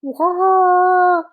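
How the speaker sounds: high, loud whirring squeal; tire whir. Shown as a demonstration. Telling the child how tires whir, high and loud. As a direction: whip it out like tires skidding round a corner. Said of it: "the tires go".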